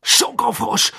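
very high, loud furious yell; angry shout. Acted. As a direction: shout it in pain and rage. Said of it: "schrie er zornig auf einem Bein hüpfend".

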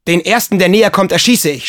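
loud, high battle cry; shouted threat. A narrator's reading. Boy in besieged shed threatens all enemies, shouting. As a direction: shout it like a commander defending a fortress. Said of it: "schrie er".

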